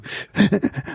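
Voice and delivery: high-pitched imitated panicky breathing; quick breathy gasps, imitating panic; mock hyperventilating. Imitating trainee panicking in sparring role play, mock hyperventilation. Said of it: "he'd hyperventilate".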